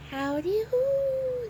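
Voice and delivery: high, slow, swooping holler; drawn-out storybook call. A narrator's reading. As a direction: stretch it into a long high whoop. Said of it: "the voice hollered".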